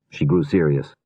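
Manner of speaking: ordinary delivery, nothing marked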